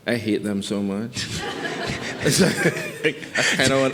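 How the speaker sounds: says it through a laugh, mock hatred; a laughing, mock-bitter confession. Real, not acted. Guest laughs as he jokes to the host about hating poets.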